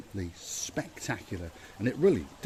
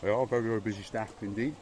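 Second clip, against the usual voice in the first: talking through a mouthful of croissant; mouth full; faster than usual. Real, not acted. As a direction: mouth full while speaking. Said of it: "Sorry for talking with my mouth full".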